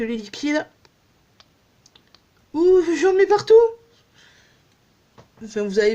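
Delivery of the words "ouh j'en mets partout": startled yelp. Real, not acted. Liquid squirts everywhere unexpectedly.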